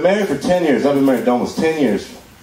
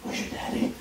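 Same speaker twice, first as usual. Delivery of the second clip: botched line, softly whispered; 13 dB quieter than usual. Retold or imitated. Husband fumbling the line to his wife, pure whisper. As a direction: breathe the wrong line into her ear. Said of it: "And I whisper".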